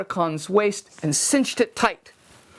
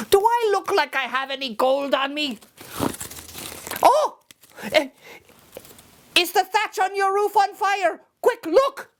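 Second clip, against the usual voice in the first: alarmed leprechaun; 13 semitones higher than usual. Acted. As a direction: fake a panic to trick him. Leprechaun tricks again.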